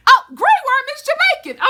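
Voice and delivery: high, loud, fast surprised exclaim; mock realization. Real, not acted. Fan suddenly realizing Grey Worm sounds Jamaican, loud and high. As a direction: act stunned by your own discovery.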